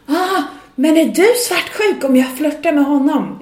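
high, loud flirty taunt; drunk woman shouting. Retold or imitated. Drunk woman taunts the singer, loud and high. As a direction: taunt her like a drunk party guest. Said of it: "började hon skrika på mig".

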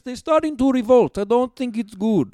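lightly voiced Soviet dictator with a vague accent; Joseph Stalin. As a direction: play an accented dictator as fashion expert. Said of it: "Joseph Stalin here".

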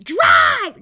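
very high, very loud bark of a command; yelled drive. Retold or imitated. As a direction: yell it at the driver as if your life depends on it. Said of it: "I'm gonna yell at you".

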